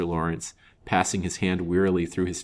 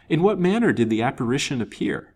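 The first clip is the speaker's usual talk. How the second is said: higher, louder, curious question; surprise and interest; 7 semitones higher, 5 dB louder than usual. A narrator's reading. Colville asks the visitor about the apparition, surprised and interested. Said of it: "in a tone of surprise and interest".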